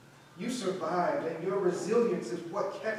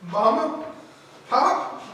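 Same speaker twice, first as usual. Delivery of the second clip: surprised; 7 semitones higher, 10 dB louder, much slower than usual. Acted. Surprised to see parents.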